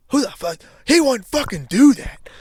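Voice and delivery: retold angry outburst. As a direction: snap back hard, half losing it. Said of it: "So now I'm flying back at him".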